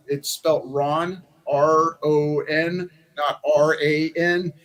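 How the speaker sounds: slow, letter by letter; patient spelling out. Retold or imitated. Candidate correcting a donor on Rand Paul, slowly spelled out. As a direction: space out each letter for someone not getting it.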